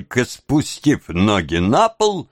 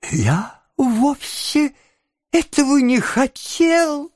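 high, whiny whimper. A narrator's reading. Noah excusing himself to the angry beadle, whimpering. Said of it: "захныкал Ноэ".

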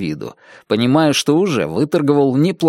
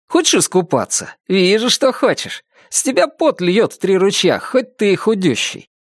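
higher, bright teasing youthful voice; cheerful young Chuck; 5 semitones higher than usual. Acted. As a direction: coax your tutor to quit for the day. (весёлый юноша, голос выше)

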